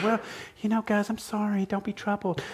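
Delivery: high, soft, sheepish apologetic voice; sheepish apology. Retold or imitated. Pastor mimics a sheepish Jesus to the disciples, timid. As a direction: say it timidly, like a sorry friend. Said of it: "This isn't just a sheepish kind of".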